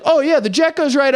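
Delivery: high, casual mock mechanic under the car; lightly hammed-up mechanic. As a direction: play the clueless guy at work.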